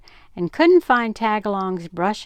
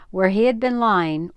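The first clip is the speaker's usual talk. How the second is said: ordinary delivery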